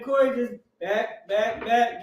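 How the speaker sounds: normal talking voice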